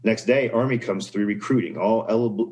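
ordinary talk, nothing marked

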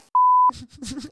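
mocking laugh.

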